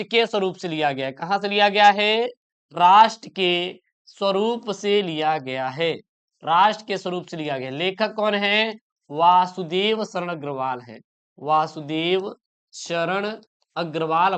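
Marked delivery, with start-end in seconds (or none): 2.7-5.9 slow, word-by-word repeat, slightly lower; drawn-out, evenly spaced words; slow dictation. Shown as a demonstration. Teacher repeats answer slowly as dictation. 11.4-14.4 slow, low and soft, name by name; unhurried, spaced-out repeat in a lowered voice; slow dictation. Shown as a demonstration. Teacher repeats author's name as dictation.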